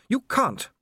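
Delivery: high, alarmed protest; urgent female plea. Acted. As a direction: stop him in alarm. Sybil pleading urgently.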